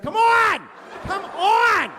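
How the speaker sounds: high, loud shout as the fed-up wife; impatient yelling. Retold or imitated. Impatient wife yelling at her husband, imitated full-force shout. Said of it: "Like, yelling".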